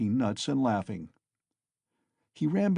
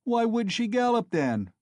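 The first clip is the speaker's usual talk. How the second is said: mocking shout; 12 semitones higher, 4 dB louder than usual. A narrator's reading. Crowd taunting Mikolka.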